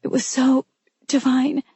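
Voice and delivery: fighting tears, voice giving way; voice breaking. A narrator's reading. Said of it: "her voice breaking".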